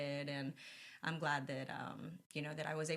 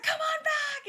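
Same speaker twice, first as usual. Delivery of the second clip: much higher and louder, eager; excited invite; 8 dB louder, much slower than usual. Retold or imitated. Imitating Jerry inviting the couple backstage, eager. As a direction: call them back with delight.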